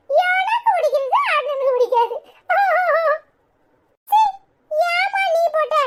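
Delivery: put-on high, giggly little kid voice; small child chattering and laughing. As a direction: play a child teasing a sibling over juice. Children thank and bicker.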